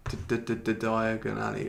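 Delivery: stammered repeated D before Diagon; stuttering. Acted. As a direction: trip over the D in Diagon several times, then get the name out. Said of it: "he's, he's stuttering".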